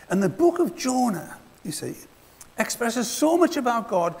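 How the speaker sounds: usual speaking voice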